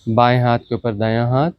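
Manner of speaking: ordinary talk, nothing marked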